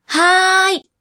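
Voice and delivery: put-on boy servant voice. Boy servant answers loudly.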